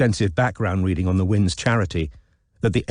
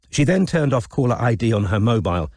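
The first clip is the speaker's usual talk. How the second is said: usual speaking voice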